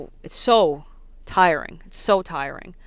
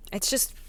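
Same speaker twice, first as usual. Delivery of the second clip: weary sigh; 2 semitones higher, 9 dB quieter than usual. Real, not acted. Sigh before I'm just over it.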